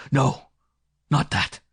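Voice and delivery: loud, forceful commands with hard pauses; ordering. A narrator's reading. Man stops the woman's pleas, ordering loudly. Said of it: "He ordered".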